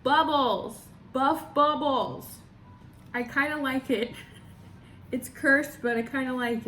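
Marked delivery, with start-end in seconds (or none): 0.0-2.3 excited exclaim. Real, not acted. Excited reading of costume name.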